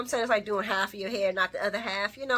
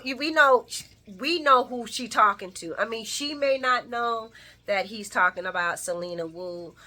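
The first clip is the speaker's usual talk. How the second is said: ordinary talk, nothing marked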